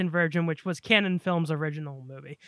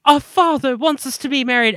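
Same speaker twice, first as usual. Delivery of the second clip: high, loud mock British accent; 6 semitones higher, 7 dB louder than usual. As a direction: put on a film princess's British accent. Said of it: "her British accent, like, in every scene".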